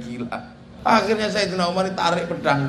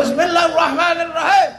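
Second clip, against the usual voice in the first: high, loud basmala shouted to the crowd; loud, rousing preacher's basmala; loud opening call; 8 semitones higher, 4 dB louder than usual. Shown as a demonstration. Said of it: "saya cuman ngecek lapangan".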